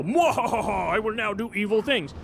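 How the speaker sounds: mock evil cackle and villain line; a lightly voiced cartoon villain. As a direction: play a mustache-twirling baddie. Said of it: "twist their evil mustache and go".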